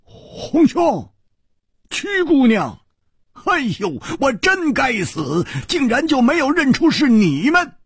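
high-pitched, dumbfounded recognition; high, stunned exclamation. A narrator's reading. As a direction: cry out in astonished self-reproach. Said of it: "讷讷地说".

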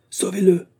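high cry for help; urgent shout. A narrator's reading. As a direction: call out for a rescue. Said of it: "cria l'agent".

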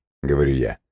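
ordinary delivery

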